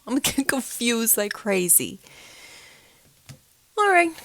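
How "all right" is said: loud, high all right; bursting exclaim. Real, not acted. Telling listeners it calmed down, loud burst. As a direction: snap out of it loudly. Shaking off the fear.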